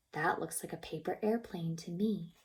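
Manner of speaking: plain reading voice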